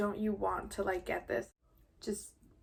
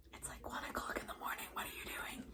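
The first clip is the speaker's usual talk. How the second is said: whisper; 16 dB quieter, faster than usual. Retold or imitated. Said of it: "The maid, she comes down".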